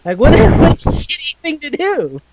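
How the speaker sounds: high-pitched, laughing through the words. Real, not acted. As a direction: crack up mid-sentence, voice shooting up. Laughing at a character's petty exit.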